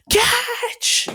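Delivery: very high, loud yell of catch; loud shout. Retold or imitated. Izuku yells as he throws energy, loud. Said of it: "He's like".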